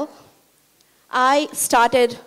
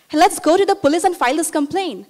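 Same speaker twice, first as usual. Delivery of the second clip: quick, higher-pitched retold appeal; urgent urging; 6 semitones higher, faster than usual. Student urging beaten neighbor to go to police, urgent. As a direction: rush to talk them into acting. Urges neighbor to report husband.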